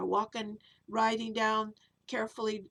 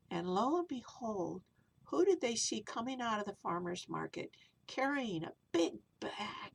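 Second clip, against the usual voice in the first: plain reading voice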